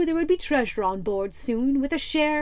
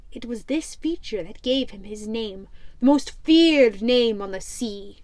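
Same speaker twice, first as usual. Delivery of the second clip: ordinary delivery, nothing marked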